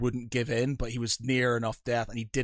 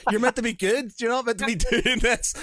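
high, exasperated telling-off with a laugh; scolding good guy; 9 semitones higher, 5 dB louder than usual. Retold or imitated. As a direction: play the exasperated do-gooder. Voicing brother's character scolding party.

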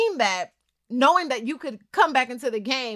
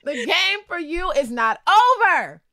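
loud, high, drawn-out rallying cry; slow and emphatic, pitched up high; rousing pep talk; 7 semitones higher, 7 dB louder, slower than usual. Real, not acted. Host tells player the game is not over, rousing and loud.

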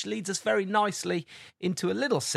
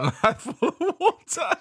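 laughing mid-sentence; breaking into laughter; 10 semitones higher, 5 dB louder than usual. Real, not acted. Laughing at friend's mouthful.

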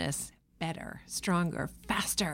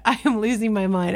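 normal talking voice

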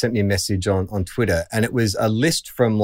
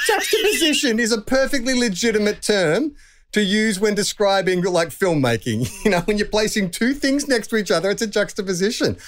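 mock indignant; 12 semitones higher than usual. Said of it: "I'm like".

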